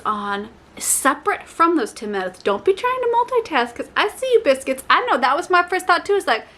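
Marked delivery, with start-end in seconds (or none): 2.4-6.1 high, playful calling-out; playful high call-out to viewers; teasing. Real, not acted. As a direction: catch them multitasking with a high tease. Teasing the audience directly.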